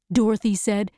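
usual speaking voice

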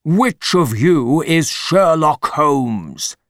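slow, forceful demand from an angry big man; angry intruder demanding an answer; angry shout. A narrator's reading. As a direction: shout it slowly, in a character voice. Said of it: "he shouted".

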